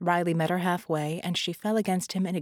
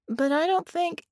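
hesitant start; 11 semitones higher, 4 dB louder than usual. Acted. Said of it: "Penny began, and stopped".

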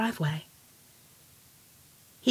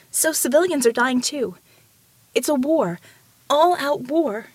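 higher, insistent warning; urgent plea; 6 semitones higher, 3 dB louder than usual. A narrator's reading. Ramona warning the mountain of all-out war, urgently. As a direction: drive home how grave it is. Pleading for help against attack.

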